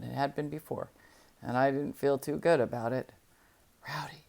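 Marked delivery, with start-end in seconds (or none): 3.9-4.2 hushed call of the dog's name; whispering. Acted. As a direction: say the name softly, barely audible. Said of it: "I whispered".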